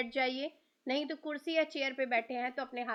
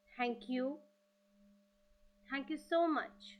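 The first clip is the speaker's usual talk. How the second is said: slow, drawn-out thanks, evenly repeated; meditative gratitude; 3 semitones lower, much slower than usual. Acted. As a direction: let each thank-you stretch out slowly, as in meditation. Leads gratitude repetitions in meditation. (ध्यान में धीरे-धीरे कृतज्ञता जताते हुए)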